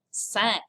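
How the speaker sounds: "cinq" with the vowel up in the nose; nasal vowel. Shown as a demonstration. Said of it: "it becomes a nasal".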